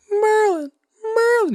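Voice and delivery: put-on high, loud child's call; kid yelling. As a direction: call out like a young boy. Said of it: "he's yelling for Merlin".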